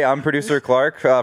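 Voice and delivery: ordinary delivery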